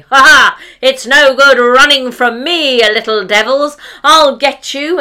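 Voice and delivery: loud gleeful yell at the turnips; hammed-up battle cry at vegetables; triumphant hunting shout. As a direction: bellow like a proud hunter. Hunter struts shooting turnips.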